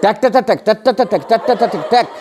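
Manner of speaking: Tac tapped out as a steady pulse; tapping the beat. Shown as a demonstration. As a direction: knock out tac like a metronome. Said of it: "rhythm, rhythm". (ট্যাক ট্যাক তাল ঠোকা)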